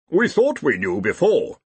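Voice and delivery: gloomy grumble from an acted dean. A narrator's reading. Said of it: "said the dean gloomily".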